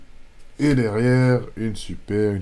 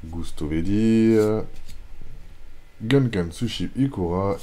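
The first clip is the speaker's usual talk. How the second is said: ordinary delivery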